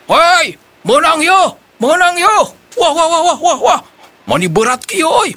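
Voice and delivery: high, thrilled yelling; excited shouting. Acted. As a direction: yell out, fighting a heavy fish. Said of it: "jung nangtung bari tuluy gogorowokan".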